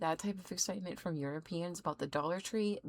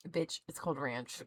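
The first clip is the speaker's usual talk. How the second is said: ordinary talk, nothing marked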